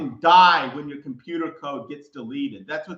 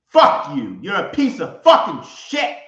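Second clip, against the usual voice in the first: raging at the demon; furious shout; 2 semitones higher, 6 dB louder than usual. Real, not acted. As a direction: spit the curse out loud. Cursing out Satan.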